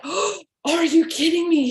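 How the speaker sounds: imitating a friend who can't believe it; stunned disbelief. Friend quoted as the kit goes to her, high and stunned.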